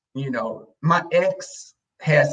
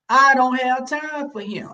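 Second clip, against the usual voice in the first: very high mama brush-off; imitated high-voiced dismissal; dismissive mother; 11 semitones higher than usual. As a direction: insist on it, pitched way up. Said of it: "My mother was like that".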